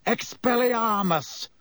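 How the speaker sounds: slow, commanding spell cry; shouting spell. A narrator's reading. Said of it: "Lupin shouted".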